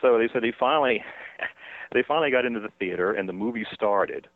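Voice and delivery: ordinary talk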